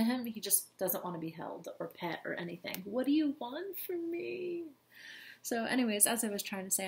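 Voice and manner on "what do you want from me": high, slow, exasperated plea. Real, not acted. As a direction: plead with the cat, fed up. Exasperated at clingy cat.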